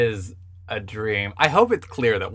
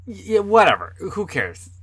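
dismissive; 9 semitones higher, 4 dB louder than usual. Retold or imitated. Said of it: "someone was like".

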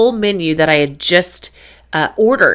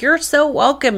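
gracious; 8 semitones higher than usual. Retold or imitated. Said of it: "I'm like".

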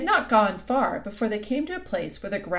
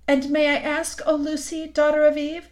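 raised, louder, ceremonious address; lightly acted faun, formal and higher-pitched; lightly voiced courtly faun; 7 semitones higher, 5 dB louder than usual. A narrator's reading. Tumnus formally questions Lucy, courtly and lightly voiced.

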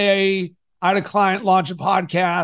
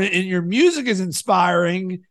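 normal talking voice

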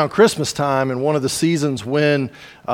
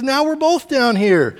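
exasperated; 11 semitones higher than usual. Retold or imitated. Stuck man exasperated.

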